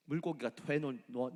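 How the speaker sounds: ordinary talk, nothing marked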